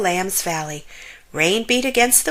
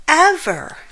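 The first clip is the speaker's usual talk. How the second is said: emphatic refusal; 8 semitones higher, 5 dB louder than usual. A narrator's reading. Larry insisting he won't go.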